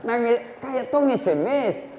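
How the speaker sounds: ordinary talk, nothing marked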